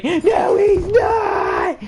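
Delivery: very high screeching contradiction; mock shrieked denial. Contradicting the cop about LeBron, mock shrieked denial. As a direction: screech it like a hammed-up tantrum.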